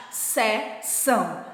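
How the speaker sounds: slow drawn-out seção for pupils to repeat; slow teacher demo of seção; model word for class. Shown as a demonstration. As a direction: stretch seção out slowly. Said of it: "Falem essa de baixo".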